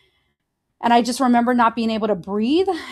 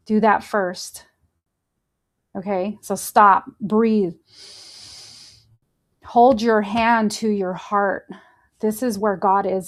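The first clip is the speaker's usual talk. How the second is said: guided breathing; 2 semitones lower than usual. Acted. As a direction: talk them through each breath.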